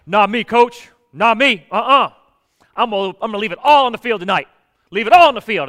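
loud, fast hype shout; captain shouting back at the coach, loud and fast; fired-up team captain. Retold or imitated. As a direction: bark it like a pumped-up captain before the game.

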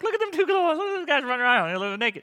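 very high, gleeful, settling down by the end; God amused with angels. Acted. God laughing with angels at Adam and Eve, gleeful and high. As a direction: chuckle at them like a delighted onlooker. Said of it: "him laughing with the angels".

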